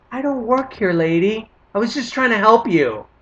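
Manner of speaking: mock outrage at being mistaken for staff; indignant retort. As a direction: snap back in disbelief. Indignant retort to rude lady.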